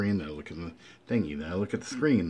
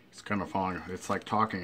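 normal talking voice

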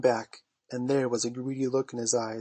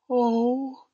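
high, loud, heartfelt oh; moved exclamation; 11 semitones higher, 6 dB louder than usual. A narrator's reading. Wendy hears of tucking boys in, moved exclamation. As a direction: cry out, melting at the idea.

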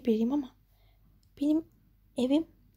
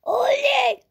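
put-on high, loud child's hooray; a girl cheering about ice cream and toys; delighted cheer; 10 semitones higher, 7 dB louder than usual. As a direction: squeal with joy.

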